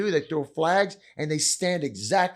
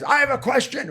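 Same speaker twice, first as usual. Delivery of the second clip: James Bond-like British voice; 12 semitones higher, 6 dB louder than usual. Retold or imitated. Barrister interrupting the panel, in a British Bond voice. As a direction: announce the question like a suave British spy. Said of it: "but sounds like James Bond".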